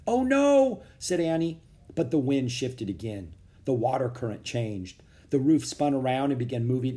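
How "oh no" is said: high, louder wail of dismay; dismayed cry. A narrator's reading. Annie hears they may reach the ocean, dismayed cry. Annie fears drifting out to sea.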